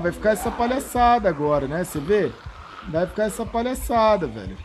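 loud, exasperated complaint; loud indignant rant. Real, not acted. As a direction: vent at the ridiculous game. Complaining at unfair game.

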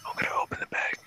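hushed whisper over the bag; ASMR whisper. Pretend. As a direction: whisper close to the mic. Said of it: "get that A S M R".

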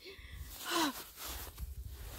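long deflated breathy sigh; long heavy sigh. Real, not acted. Long heavy sigh after losing the biggest fish. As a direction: sigh out the disappointment.

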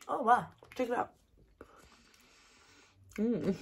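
ordinary talk, nothing marked

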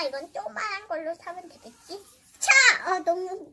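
usual speaking voice

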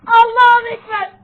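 loud startled cry; real scared shout; frightened exclamation. Real, not acted. Scared by a scary sound.

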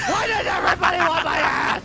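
high, loud mock-outraged cry; exasperated shout. Real, not acted. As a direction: protest loudly with comic exasperation. Mock protest at teammates bumping him.

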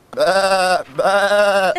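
loud lamb bleats; bleating lambs. Acted. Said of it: "bleated some lambs".

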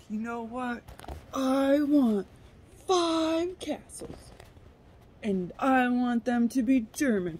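a ridiculous demanding character; hammed-up crackhead wanting five castles, high and loud; silly demanding voice. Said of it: "God, I sound stupid. I was trying to do that".

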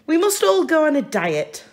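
mother announcing diet. Acted. Mrs Large announces the family diet, bossy. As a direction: lay down the law brightly.

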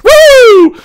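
piercing high whoop of thrill; exultant whoop. Acted. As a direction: let out a wild cheer. Pilot whoops mid-dogfight.

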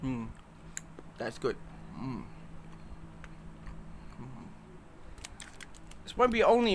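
chewing while humming; savoring food while talking; mouth full, chewing. Real, not acted. Seen 2: Chocolate put in mouth at -7; pursed, working lips throughout.

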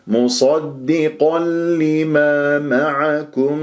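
مصدقا لما معكم slow, doubled l not overstretched; measured recitation, neat merge in مصدقا لما; correct tajweed recitation. Shown as a demonstration. Teacher shows the correct way, measured joined recitation. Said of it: "بهذه الطريقة".

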